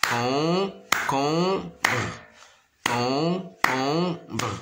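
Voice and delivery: "Concombre" in three separated beats; stretched syllables with gaps in "Concombre". Shown as a demonstration. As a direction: sound out "Concombre" as con, com, bre. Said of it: "se compose de trois syllabes".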